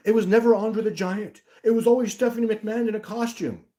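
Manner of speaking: high, loud put-on insistence; mock conspiracy theorist. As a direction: parody a believer insisting on nonsense. Acts out absurd theorist claim.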